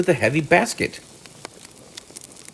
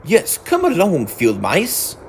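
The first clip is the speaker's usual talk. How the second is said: a touch of eager, raised Mole; eager welcome; 6 semitones higher, slower than usual. A narrator's reading. Mole inviting the field mice in, eager. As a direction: beckon them in eagerly. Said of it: "cried the mole eagerly".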